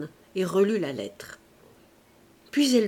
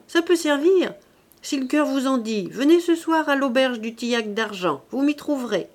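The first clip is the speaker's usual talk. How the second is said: high, raised calling voice; cri d'homme enroué; 5 semitones higher, 5 dB louder than usual. A narrator's reading. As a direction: shout after her to tempt her back. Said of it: "la voix enrouée de l'homme qui lui criait". (appel crié, voix haute et forte)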